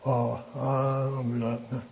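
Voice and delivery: slow, soft brush-off in a put-on voice; mock dismissive. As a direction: wave the advice away. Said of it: "they say".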